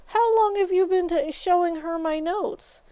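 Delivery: high, loud re-enacted confrontation; indignant retort. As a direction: demand an answer, acting it out. Retold confrontation over shared notes.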